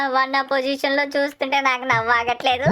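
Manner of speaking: ordinary delivery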